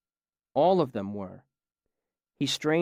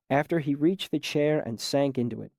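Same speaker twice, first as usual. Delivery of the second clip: ordinary delivery, nothing marked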